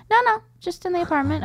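high, innocent reassurance; squeaky denial from the dad; mock dad voice.

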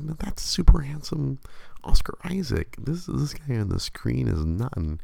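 high, indignant wife voice; imitating the wife rejecting the photo; mock disbelief. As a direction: insist he can't be the same man. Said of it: "She's like".

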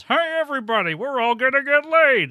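Rodney Dangerfield voice. Retold or imitated. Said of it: "Rodney Dangerfield pops in and goes".

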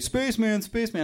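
high-pitched mock calling out; high imitation of a crowd's cry; imitated onlookers calling. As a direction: call it high, like an excited passerby. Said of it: "everybody's like".

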